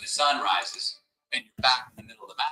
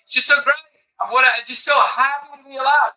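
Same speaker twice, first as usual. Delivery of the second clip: high, loud, gushing fake bliss; sing-song mockery of endless happiness; mock giddy cheer; 14 semitones higher, 9 dB louder than usual. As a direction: send up a blissed-out optimist, all sunshine. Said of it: "We can't just all run around going".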